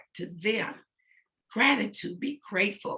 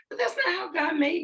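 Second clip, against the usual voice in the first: light high voice; 10 semitones higher than usual. Shown as a demonstration. Still in the fake voice, explaining God made her otherwise. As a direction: carry the put-on high voice through the line. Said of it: "I wanted to talk like this".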